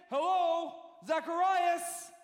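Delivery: excited preaching as Gabriel announcing glad tidings; excited angel. Acted. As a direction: proclaim it like a thrilled herald. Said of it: "See, I'm getting excited".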